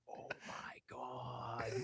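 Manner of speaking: very slow, barely-there whisper; stunned whisper. Retold or imitated. Watching trash spray all over the studio, re-enacted stunned whisper.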